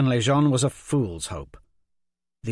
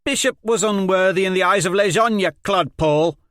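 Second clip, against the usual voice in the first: loud, higher retort; heated counter; 9 semitones higher, 8 dB louder than usual. Acted. Liz Hen counters Jenko, loud heated retort. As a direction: argue back hard. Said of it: "Liz Hen countered".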